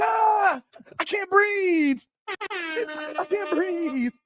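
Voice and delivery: panicked gasping cry; high, slow, desperate wheeze. Retold or imitated. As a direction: beg for air in a panic. Gasping at gunpoint.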